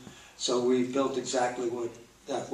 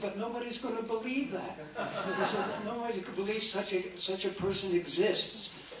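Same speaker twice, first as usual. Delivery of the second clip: director objecting; 8 semitones higher than usual. Retold or imitated.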